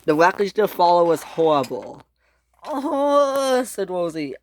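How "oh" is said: long high moan; pained groan. Acted. Rosie in the wreckage, pained moan. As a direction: moan in pain, high and drawn out.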